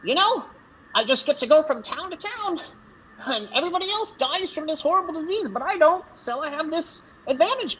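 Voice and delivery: high-pitched chatty goblin; high goblin voice, rattling on about business; goblin merchant. Acted. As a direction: talk shop as a little goblin trader.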